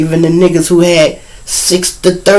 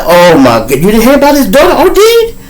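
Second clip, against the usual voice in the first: alarmed gossip; 9 semitones higher, 5 dB louder than usual. Retold or imitated.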